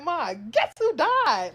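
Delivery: high, drawn-out gleeful announcement. Retold or imitated. Child breaking big news to mom, gleeful. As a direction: say it with a huge grin, stretching it out. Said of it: "Like, with the biggest fucking".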